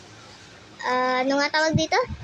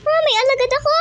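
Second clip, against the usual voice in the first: excited; 10 semitones higher, 4 dB louder, faster than usual. Real, not acted. Excited find in game.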